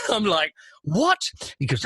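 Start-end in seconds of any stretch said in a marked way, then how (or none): 0.8-1.2 high, baffled squeak; startled what. Retold or imitated. Presenter stunned by the footballer's outburst, squeaking in surprise. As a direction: pitch the word up in shock.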